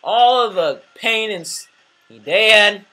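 loud, high lament over a mistake; dismayed outburst. Real, not acted. Gamer who messed up the video, dismayed yelling. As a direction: cry out in dismay, then keep lamenting loudly.

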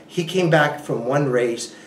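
usual speaking voice

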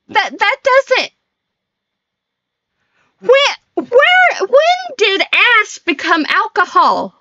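high, disbelieving outburst; incredulous. Real, not acted. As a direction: voice climbs in disbelief. Baffled by chat's slang.

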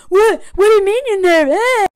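high, loud imitation of an angry husband; hammed-up irate outburst; mock mad. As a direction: splutter indignantly, ending in a grunt. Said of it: "he gets mad that she's nervous".

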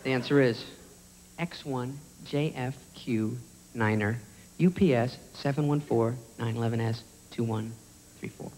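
low, measured clue reading; hushed, deadpan host voice; game show host. Acted. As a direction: read the code out soberly, low and spaced.

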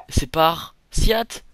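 fort, lent, mots détachés; découpe chaque morceau pour préparer la blague; jeu de mots annoncé. Pretend. Jeu de mots avant le cri. (calembour lancé fort et lentement)

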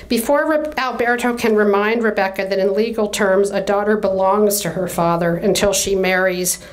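usual speaking voice

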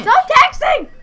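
high, loud yell; shouting. Real, not acted. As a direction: snap at her to stop. Sibling yells at sister.

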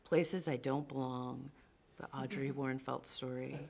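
usual speaking voice

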